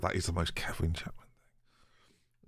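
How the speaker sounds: unvoiced, slow whispered aside. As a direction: breathe it as a secret joke.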